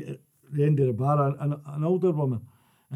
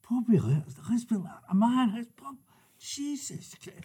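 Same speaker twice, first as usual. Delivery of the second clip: hushed, rising awe, higher and higher; awestruck hush; 7 semitones higher, 6 dB quieter than usual. Retold or imitated. Pub regular marvelling at Bobby Lennox, hushed and awestruck. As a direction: breathe it out in disbelief, climbing high at the end.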